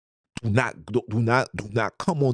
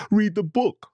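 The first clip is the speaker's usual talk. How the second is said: much higher mocking know-it-all voice; mocking the Eurocentric guy; 14 semitones higher than usual. Mimicking a brother who says read European books, higher. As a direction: squeak it out like a smug lecturer.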